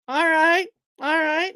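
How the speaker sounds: high, happy all right; excited cheer. Real, not acted. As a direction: cheer the viewers on. Cheering at likes.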